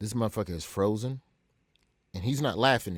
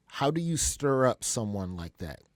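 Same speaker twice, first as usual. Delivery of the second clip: normal talking voice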